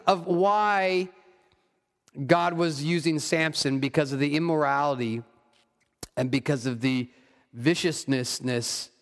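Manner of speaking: ordinary talk, nothing marked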